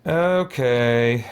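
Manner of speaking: normal talking voice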